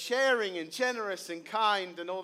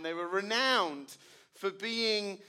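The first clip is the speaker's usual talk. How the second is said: ordinary delivery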